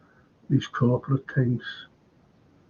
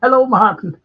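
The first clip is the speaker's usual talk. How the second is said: high, loud put-on Irish accent; 8 semitones higher, 6 dB louder than usual. Host re-reading Sean's greeting, high Irish accent. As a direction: greet him in a lilting mock-Irish voice. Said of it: "Wonder if that's in an Irish accent".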